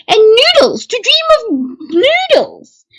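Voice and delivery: high-pitched, drawn-out mock grand proclamation; theatrical announcement. Reading a dream book to viewers, theatrical high voice. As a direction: announce it far too grandly, slow and high.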